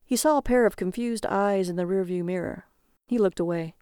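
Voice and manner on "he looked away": plain reading voice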